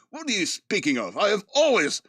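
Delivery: hammed-up British accent, rising high on "always"; insisting in a mock British accent that he always talked this way. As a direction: play an affronted Englishman defending his accent. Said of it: "all of a sudden I have a British accent".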